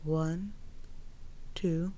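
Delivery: slow, soft breath counting. Acted. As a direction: count the breaths slowly and softly.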